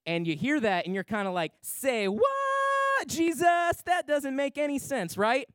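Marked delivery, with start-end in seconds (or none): 1.6-5.2 squeaky high incredulous; mock disbelief. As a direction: leap way up in disbelief.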